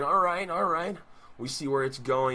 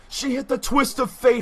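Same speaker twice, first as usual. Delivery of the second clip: excited call; 8 semitones higher, 5 dB louder than usual. Acted. Excited big-move call.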